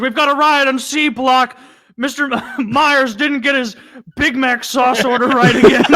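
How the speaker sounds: high, loud, urgent mock prison guard; mock riot alarm. Guard reporting a prison riot over a sauce order, mock alarm.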